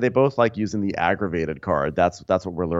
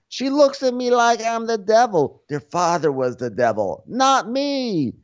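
much higher, slow indignant whine; mock Joe Gorga; 14 semitones higher, slower than usual. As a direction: whine about the devil, pitched way up. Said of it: "Joe Gorga is like".

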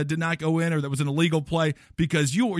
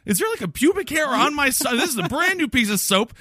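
much higher, panicky mock disbelief; hammed-up horror over a soap bar; mock horrified referee; 8 semitones higher than usual. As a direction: squeal it like a disgusted guest. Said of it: "you're like".

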